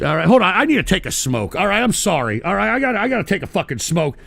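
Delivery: ordinary delivery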